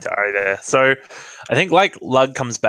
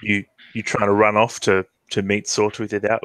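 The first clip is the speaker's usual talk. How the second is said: usual speaking voice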